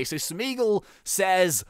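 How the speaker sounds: normal talking voice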